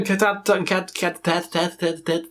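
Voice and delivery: stuttering like Porky Pig; mock cartoon pig stammer. Tripping over the band name like the cartoon pig. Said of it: "That sounded like some, that pig".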